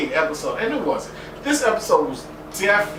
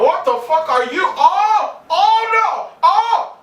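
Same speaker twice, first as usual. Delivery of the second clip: much higher, louder shrieks of terror; panicked screaming; 8 dB louder, slower than usual. Retold or imitated. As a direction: freak out like a terrified baby. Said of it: "'cause I'm like".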